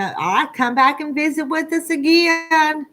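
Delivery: ordinary talk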